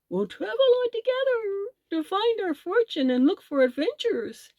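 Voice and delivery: high, bouncy elephant voice; jolly elephant. A narrator's reading. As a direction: play the happy elephant with big glee. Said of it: "said the elephant, as he capered about".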